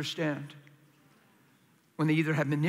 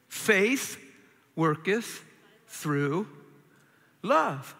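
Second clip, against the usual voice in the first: Faith, worketh, through, love, each alone with long pauses; word by word; much slower than usual. Shown as a demonstration. Preacher spelling out scripture slowly, word by word. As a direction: lay out every word separately. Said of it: "slow word-by-word delivery".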